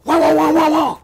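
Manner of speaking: high, loud wah wah alarm noise; loud high siren sound acting out the warning; imitated alarm wail. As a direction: mimic the cockpit alarm blaring.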